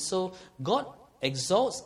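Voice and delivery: ordinary talk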